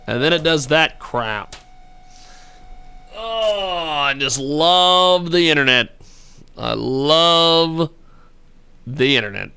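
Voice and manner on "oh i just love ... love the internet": slow, drawn-out mock adoration; sarcastic. Real, not acted. Host fed up with failed calls on air, sarcastic. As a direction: stretch the words with heavy sarcasm. Sarcasm as the connection keeps failing.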